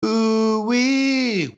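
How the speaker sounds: high, loud whooping cry; excited whoop. Real, not acted. Co-host whoops at a joke, loud and high. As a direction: let out a delighted whoop. Reacting to fist-ramming suggestion.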